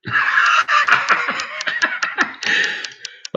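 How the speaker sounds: breathless squealing laugh, no voice; real wheeze of laughter at a joke; high wheezing laugh. Real, not acted. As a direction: burst into a high airy laugh.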